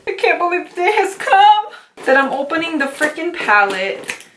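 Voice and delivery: high-pitched, giddy excitement; giddy disbelief. Real, not acted. Long-awaited palette finally being opened.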